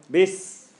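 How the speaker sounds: "this" said as "bis", ending in a long hiss; short b, then sustain the s in "this"; held s. Shown as a demonstration. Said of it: "Tongue ain't doing anything".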